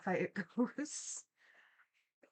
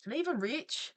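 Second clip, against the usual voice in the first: ordinary talk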